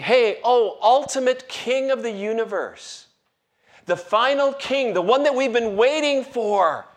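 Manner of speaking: high, loud call to a king; mock-grand cry of acclaim; acted acclamation. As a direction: hail the long-awaited king, pitched well up. Acts out calling to the king.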